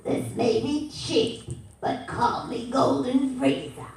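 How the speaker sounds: slow, deliberate villain line; lightly voiced Frieza; Frieza voice on request. Shown as a demonstration. Fan asks voice actor to say the line, slow villain read. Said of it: "Can you please say".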